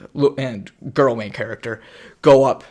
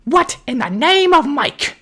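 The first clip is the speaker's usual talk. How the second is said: mock startled conductor; 14 semitones higher, 7 dB louder, slower than usual. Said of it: "So go".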